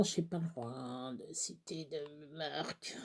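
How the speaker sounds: à voix basse, comme une confidence; glisse-le tout bas, en confidence; chuchoté en aparté. Real, not acted. Aparté sur les marques interdites. (chuchotement complice)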